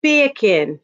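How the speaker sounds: mock British "Beer can", sounding like Jamaican "bacon"; British accent. Shown as a demonstration. Streamer leads chat in trying it, British accent. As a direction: say "Beer can" British, dropping the R, like "bay-kin". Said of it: "with a British accent".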